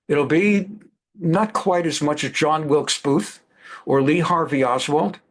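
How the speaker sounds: ordinary talk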